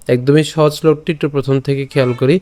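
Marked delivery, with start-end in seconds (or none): none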